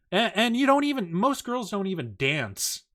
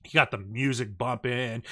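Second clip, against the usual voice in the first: usual speaking voice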